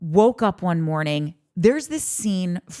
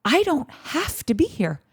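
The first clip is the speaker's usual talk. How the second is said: dreamy realization; 4 semitones higher than usual. Retold or imitated. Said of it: "where she goes".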